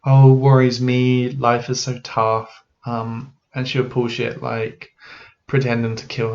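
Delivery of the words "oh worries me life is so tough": slow, mopey mimic of the mum. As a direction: drag it out like a mopey, self-pitying mum. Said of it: "she's always mopey".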